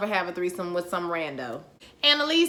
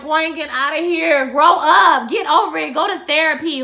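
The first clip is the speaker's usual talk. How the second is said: put-on louder and higher, telling Boy get outta here off hard; scolding; 5 semitones higher, 8 dB louder than usual. Host scolding Gabriel for whining about his dad, loud and fed up. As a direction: tell him off like a fed-up older sister.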